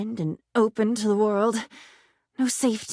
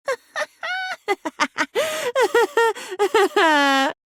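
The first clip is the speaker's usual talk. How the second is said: mocking laugh; 11 semitones higher than usual. Laughs after insult.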